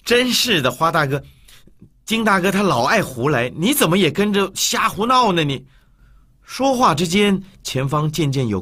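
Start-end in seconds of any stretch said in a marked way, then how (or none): 0.0-5.4 exasperated amusement. Acted. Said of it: "又好气又好笑".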